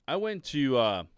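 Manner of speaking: ordinary talk, nothing marked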